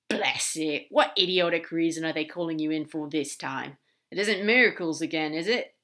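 loud, exasperated outburst; annoyed demon. Acted. Crowley objecting to Aziraphale's summons, exasperated. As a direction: grumble loudly in indignation for a friend.

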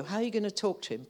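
normal talking voice

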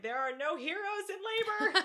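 high, loud, laughing proclamation; mock labor yell. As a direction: holler it like a rallying cry. Retold yelling during labor.

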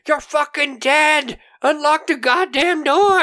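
high, strained threat yelled through a door; angry shouting. Retold or imitated. Old lady trapped in the closet, angry shouting. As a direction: holler it like someone pounding to get out. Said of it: "pounding in the closet".

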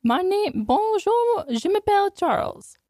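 very high mock French dog voice; Charles's French accent. Host speaking for her dog, high French accent. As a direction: voice a little French lapdog, high and playful. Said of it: "he will be speaking to us in the accent".

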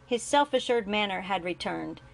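plain reading voice

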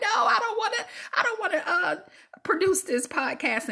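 high, laughing whine; mock whiny refusal. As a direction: whine it like a sulky kid. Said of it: "be like".

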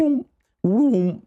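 high mock engine vroom; playful vroom vroom sound effect; imitated vroom. Imitating phone flip sound.

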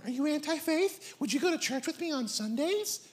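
lightly put-on girlish voice; woman on the dating show. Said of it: "This is her talking".

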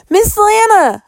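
high, loud scream of her name. A narrator's reading. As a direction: scream it in panic. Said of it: "I screamed".

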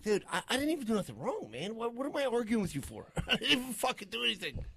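high, pleading protest of innocence; mock protest. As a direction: act wronged and baffled. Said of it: "I'm like".

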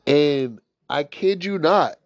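usual speaking voice

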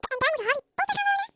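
loud, high incredulous question; outraged, climbing exclamation; appalled disbelief. A narrator's reading. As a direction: protest with rising indignation. Appalled objection to Harry's new home.

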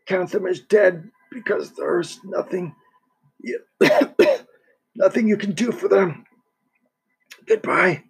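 put-on sick old man pushing words out between coughs; weak dying man coughing. Dying Vitaly voiced, coughing.

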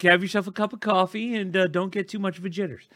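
falsetto calming voice; squeaky reassuring voice; high soothing mock voice.

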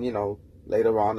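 ordinary talk

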